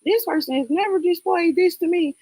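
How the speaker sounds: high mock voice imitating a clueless friend; mocking a naive friend. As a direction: put on a high, sing-song voice to mock the naive view.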